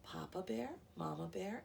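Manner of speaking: plain reading voice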